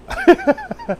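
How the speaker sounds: very high, squeezed giggle; overcome laugh. Real, not acted. Preacher admitting he is messed up, overcome laugh. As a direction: laugh helplessly at your own emotion.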